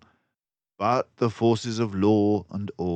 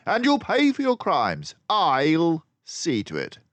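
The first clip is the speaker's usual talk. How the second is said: high, loud and stern; stern detective; 11 semitones higher, 5 dB louder than usual. Acted. Basil sternly threatening the crooks. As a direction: promise justice. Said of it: "said Basil sternly".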